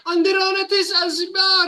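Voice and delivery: high, loud Elvish outburst; high shouted elvish phrase; elf woman in Elvish. Acted. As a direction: exclaim in a foreign tongue.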